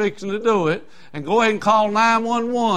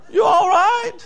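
higher mock question with a laugh; 5 dB louder, slower than usual. Preacher mocking the crowd's acceptance, laughing. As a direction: laugh through a mocking "You all right?". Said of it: "mocking the world's message".

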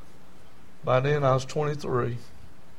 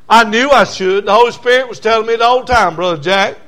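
loud, raised testimony; insistent, louder pulpit delivery; forceful preaching; 7 semitones higher, 11 dB louder than usual. Acted. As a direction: preach it out to the congregation.

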